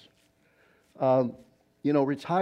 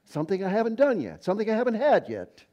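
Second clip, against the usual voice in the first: higher, fast and eager; kind of excited; 7 semitones higher, faster than usual. Retold or imitated. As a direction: brighten up as if offered a treat. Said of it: "he got kind of excited".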